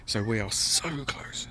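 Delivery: unvoiced hushed whisper. Real, not acted. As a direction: whisper it with suspense. Tense near-level moment.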